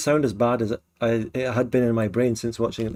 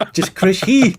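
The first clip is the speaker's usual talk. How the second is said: loud, high mock-Kiwi call; mimicked Kiwi shout; 7 semitones higher, 7 dB louder, slower than usual. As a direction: parrot the clip at full volume. Mimicking the clip, loudly.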